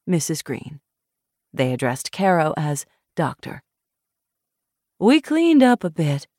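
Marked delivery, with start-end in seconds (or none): 5.0-6.3 put-on upcountry Southern accent. A narrator's reading. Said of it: "Missus Foster said".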